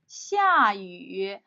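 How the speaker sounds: 下雨 with falling fourth then dipping third tone; practicing tones. Shown as a demonstration. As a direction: model 下雨: sharp fall on xia, low dip on yu. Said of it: "A fourth and third tone again".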